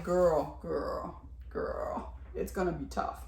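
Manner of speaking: fading to a near whisper; hushed girlfriend-to-girlfriend warning; knowing sympathy. Retold or imitated. Acting own sympathetic warning to her.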